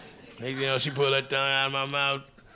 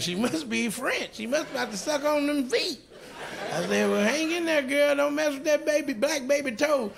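nervous high chatter; 8 semitones higher than usual. Retold or imitated. Retells his own panicked thoughts.

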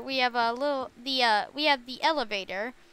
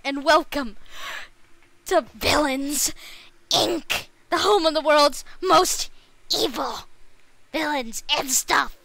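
talking doll; 2 semitones higher, 5 dB louder than usual. Acted. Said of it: "I can't keep doing that, uh, voice".

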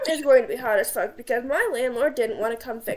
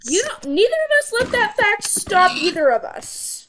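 teasing; 8 semitones higher, 7 dB louder than usual. Real, not acted. Teasing friend.

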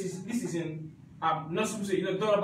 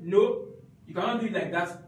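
usual speaking voice

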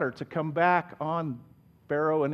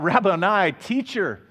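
crying out; 4 semitones higher, 6 dB louder than usual. Retold or imitated. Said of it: "Mary cries out".

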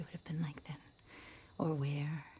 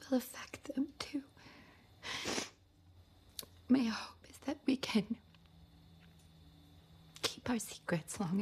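higher, strained voice close to tears; choked-up, halting love-letter reading; tearful confession; 5 semitones higher than usual. Acted. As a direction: fight back tears while confessing, breath catching between phrases. Confessing affair, fearing for her children.

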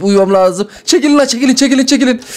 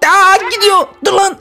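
alarmed; 9 semitones higher than usual. Acted. Player escaping, doll alarmed.

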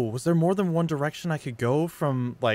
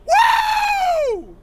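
very high, loud whoop; victory whoop; 10 dB louder than usual. Real, not acted. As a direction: whoop in triumph. Celebrating victory.

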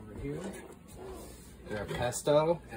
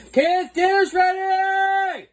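calling out; 13 dB louder, much slower than usual. Real, not acted. Calling kids to dinner.

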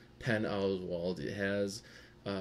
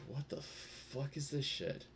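high, soft, drawn-out bafflement; stunned disbelief; 6 semitones higher, 6 dB quieter, slower than usual. Retold or imitated. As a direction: retell it slowly, under-voiced and incredulous. Retelling disbelief at the film.

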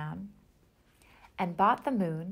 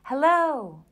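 high, loud call across the mountains; shouting; 7 semitones higher, 9 dB louder than usual. A narrator's reading. Bear on the mountain shouting to the moon. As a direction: throw the hello out to the moon. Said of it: "and again he shouted".

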